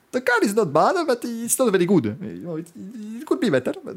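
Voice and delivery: high, slow, hammed-up Italian English; Italian accent English. Valentino answering the turn six question in heavy Italian accent. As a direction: drag out allora like a flustered rider.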